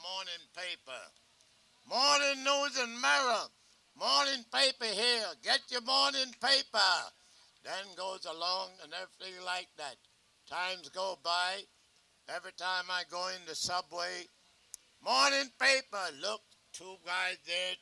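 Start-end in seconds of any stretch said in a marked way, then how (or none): 1.9-7.1 loud, high newspaper hawker's shout; newsboy's cry. Shown as a demonstration. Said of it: "let him demonstrate his pitch". 15.0-16.1 loud, high newspaper hawker's shout; newsboy's cry. Shown as a demonstration. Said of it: "let him demonstrate his pitch".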